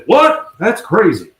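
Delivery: loud, high incredulous outburst; mock outrage, pitch leaping up; shocked disbelief. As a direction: imitate a man appalled by the news. Hank reacting to the fence news.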